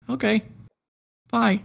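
high-pitched little goodbye; imitated girl's reply. Girl sees him leave, imitated in a high voice.

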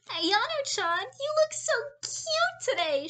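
squealed high and loud, sugary sweet; fake-cute high squeal. A narrator's reading. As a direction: gush like a bubbly schoolgirl. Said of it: "she squealed".

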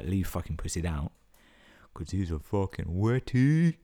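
ordinary talk

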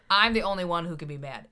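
ordinary talk

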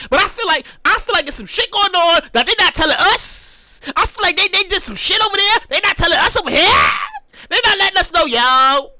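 ordinary talk

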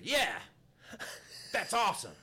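high, loud sarcastic agreement; grumpy old man snarling sarcastically; mock snarl. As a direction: sneer it back at him. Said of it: "Snarled at me".

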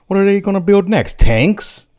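high, loud incredulous question; indignant outburst. Acted. Macker ranting about his converted factory, indignant. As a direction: throw it out in comic outrage.

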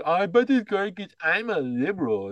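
hammed-up, high-pitched mock voice; mocking liberal buyer. Ridiculing the car buyer as stupid, exaggerated mocking voice. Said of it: "who talks like that".

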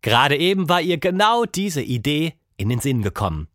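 ordinary delivery, nothing marked

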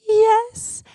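high, thin drawn-out answer; imitated surrender. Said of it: "And she says".

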